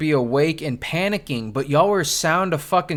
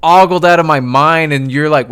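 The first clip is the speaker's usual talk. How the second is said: normal talking voice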